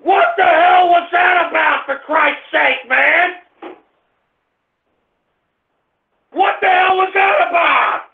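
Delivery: high-pitched, drawn-out angry yelling; outraged shouting. Real, not acted. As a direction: holler in protest, stretching the words. Host enraged at prank on engineer.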